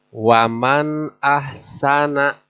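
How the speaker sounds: Waman ahsana with the nun said clearly before the hamzah; clear tajwid recitation. Shown as a demonstration. Teacher recites Waman ahsana slowly with the nun clear. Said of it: "Itu kan dibacanya harus jelas".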